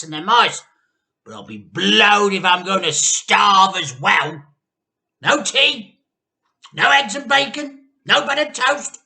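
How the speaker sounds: put-on very high, very loud bossy old crone; Grandma shouting. A narrator's reading. Grandma shouts down at the family, demanding her tea. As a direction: bellow it like a tyrannical old hag. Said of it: "she shouted".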